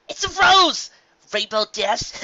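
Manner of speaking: high, loud gleeful crowing; triumphant cheer. Acted. Rainbow Dash cheering herself on, high and loud. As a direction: crow over your own win. Dash celebrates finding the shard.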